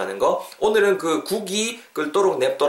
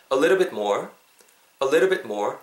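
"A little bit more" as one smooth chunk; linked in one go; much slower than usual. Shown as a demonstration. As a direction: model A little bit more without breaks. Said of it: "한 번에 따라해보세요". (A little bit more를 한 번에 이어서)

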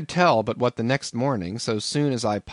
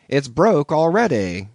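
higher, louder teasing innkeeper; landlord's joke; 5 semitones higher, 6 dB louder than usual. A narrator's reading. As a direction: savour the joke about heads. Landlord deadpans.

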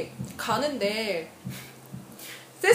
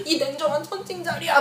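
high tearful whine, imitating herself; re-enacted weepy complaint; retold crying; 5 semitones higher than usual. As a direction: wail it as a mock sobbing accusation. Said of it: "하면서 울면서".

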